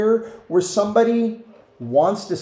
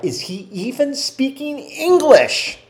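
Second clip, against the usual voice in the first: bewildered listener; 8 semitones higher, slower than usual. Retold or imitated. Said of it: "and you're like".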